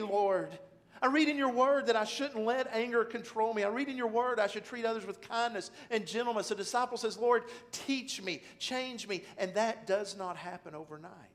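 loud, high impassioned sermon delivery; preaching at full force. Acted. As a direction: pour it out like a fervent prayer.

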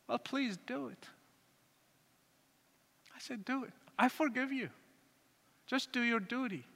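soft, resigned pleading; subdued plea. Retold or imitated. Son telling his father to do his duty, softly pleading. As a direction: retell a quiet surrender, voice held down.

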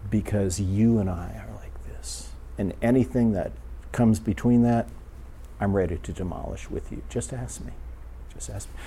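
very soft, dropping toward a whisper; hushed divine reassurance. Retold or imitated. God promising closeness to the one praying, hushed reassurance. Speaker voices God's tender reply.

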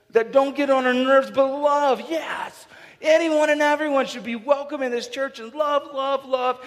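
high, loud preacher's shout on "love"; rally the room, full voice; preacher lifting the congregation; fervent preaching. Acted.

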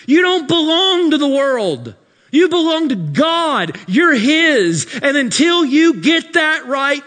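loud, high preaching climax; full-force preaching. Acted. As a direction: thunder it to the congregation.

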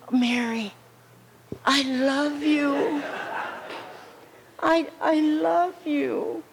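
dying friend pours her love into a last goodbye, slow and tender. Retold or imitated. Dying Ella Mae tells Mary she loves her, slow and tender.

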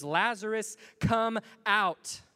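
Jesus shouting into the tomb, a loud commanding scream. Acted. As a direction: call him out of the grave at full voice. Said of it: "he literally screams".

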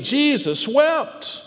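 slow, high, questioning recital; slow pondering. Shown as a demonstration. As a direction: stretch each word as if puzzling over it. Puzzling over the verse's meaning.

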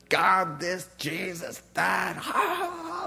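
high-pitched put-on comedy-club cackle; mock belly laughing. Preacher imitates drunk comedy-club crowd, mock laughter. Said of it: "and belly laughing".

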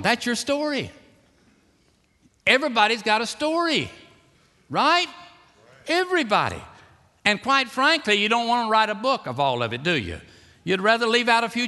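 high, loud revival preaching; fiery preaching. Acted. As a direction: preach it with rising fervor. Preacher holds high, loud delivery.

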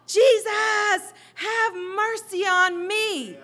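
loud, high, drawn-out desperate shout; crying out. Acted. Blind man calling to Jesus for mercy, shouting out. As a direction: cry it out at full force, stretching the words. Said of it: "he begins to cry out".

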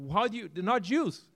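ordinary delivery

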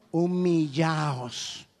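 very slow, low, stretched "Humillaos"; drawn-out command. Acted. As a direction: let "Humillaos" sink in, stretched long. Preacher draws out key verse. ("Humillaos" muy lento y grave)

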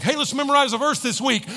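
high, chirpy suggestion; mock eagerness. Churchgoer picking the shortest verse, mock eager. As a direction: pitch it up like a keen volunteer.

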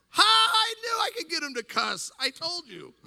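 very loud, high crowing; triumphant gloating. Retold or imitated. Dad in heaven gloating over son's cussing, triumphant shout. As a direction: crow in hammed-up victory, easing off.

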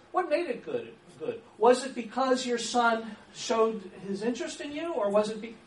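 pushes a menu of closed options; menu question demo. Shown as a demonstration. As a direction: pile on either-or guesses. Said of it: "now I'm getting into menus".

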